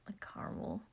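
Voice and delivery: dreamy echo. Acted. Patton swooning over Logan, soft low whisper. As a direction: savour it, half to himself, quiet. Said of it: "He even licked my caramel".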